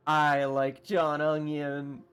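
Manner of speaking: drawn-out loud doting voice; hammed-up affection; slow loud mock fondness. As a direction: savor the name, slow and loud. Fond comment on the name.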